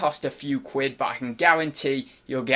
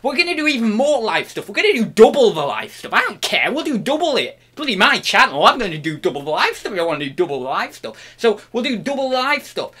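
defiant excitement; 5 semitones higher, 7 dB louder, faster than usual. Acted. Defiantly piling up live stuff.

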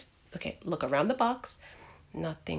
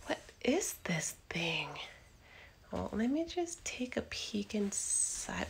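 hushed nervous whisper; 9 dB quieter than usual. Acted. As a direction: whisper while peeking in. Peeking in box.

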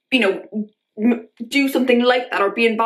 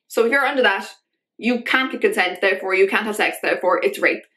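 ordinary talk

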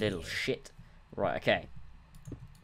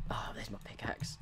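whispering; 9 dB quieter than usual. Real, not acted. Relieved find in game.

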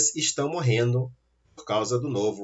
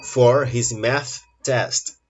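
slow sentence reading; 4 dB louder, much slower than usual. Shown as a demonstration. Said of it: "reading the gapped sentence slowly".

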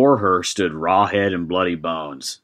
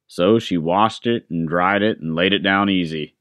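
usual speaking voice

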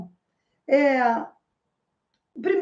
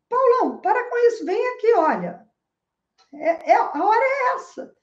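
higher, louder call to a buddy; calling out to a friend; 7 semitones higher, 3 dB louder than usual. Retold or imitated. Speaker again hails the imaginary Paulão so the muggers hear, high and loud. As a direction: playfully wave the friend over. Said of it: "aí eu falei".

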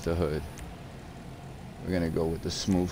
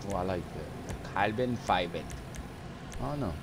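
normal talking voice

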